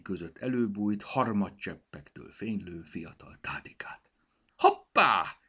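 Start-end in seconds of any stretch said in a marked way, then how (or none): 4.6-5.4 high, loud cry of discovery; surprised exclamation. A narrator's reading. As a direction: exclaim as you spot something. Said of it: "kiáltotta".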